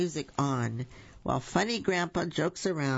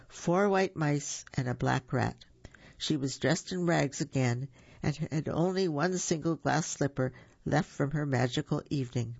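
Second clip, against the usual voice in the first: ordinary delivery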